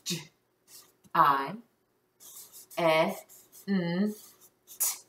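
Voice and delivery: "G I A N T" as sounds with long gaps, G as j; isolated phonics sounds. Shown as a demonstration. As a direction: say "G I A N T" one phoneme at a time. Checking word sound by sound.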